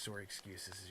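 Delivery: put-on soft, low Canadian accent on "sorry". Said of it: "I did a Canadian sorry there".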